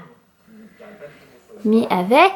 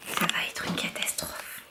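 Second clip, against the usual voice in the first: whisper; 7 semitones lower, 15 dB quieter than usual. Real, not acted. Mother whispering so her drowsy baby can fall asleep. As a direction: whisper it right under your breath.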